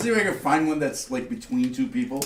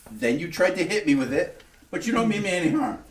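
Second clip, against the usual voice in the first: incredulous, higher and louder; indignant; 7 semitones higher, 4 dB louder than usual. Acted. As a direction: demand answers, outraged. Character confronts attacker.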